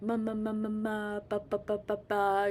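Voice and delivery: light sing-song baby babble, lightly voiced; baby babbling. Shown as a demonstration. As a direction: babble like an infant playing with sounds. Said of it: "later it turns into babbling".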